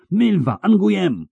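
high, loud desperate calling out; a witcher calling out to his friends in a vision, yelling. A narrator's reading. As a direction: shout the names of friends in danger. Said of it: "wrzasnął Geralt".

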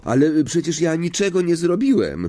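surprised pupil's objection; protesting boy. A narrator's reading. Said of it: "powiedział Harry".

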